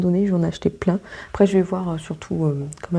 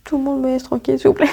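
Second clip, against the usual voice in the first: higher, whining plea to be left alone; whiny complaint; 7 semitones higher than usual. Pretend. Pretending to tell her coworkers she's tired, in a high whining plea. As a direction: play the exhausted worker begging for peace, pitched up. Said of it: "je vais dire".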